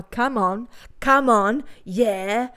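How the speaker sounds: hint of a Tony Soprano Brooklyn accent; imitating the guy's fake Brooklyn mobster voice; mock gangster accent. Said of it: "sounding like fucking Tony Soprano".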